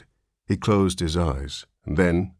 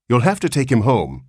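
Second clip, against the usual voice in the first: insistent; 6 semitones higher, 5 dB louder than usual. Acted. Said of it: "insisted the nurse".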